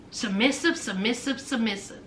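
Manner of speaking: low, soft sing-back of the word; mocking echo. Host mocking Nigerian men's talk of women, quiet echo.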